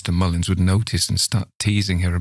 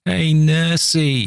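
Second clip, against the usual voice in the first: high, slow, louder taunting call; 10 semitones higher, 4 dB louder, much slower than usual. Acted. Old man taunting the fleeing nurse, calling out. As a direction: call after her, drawn out and mocking. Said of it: "he called out after her".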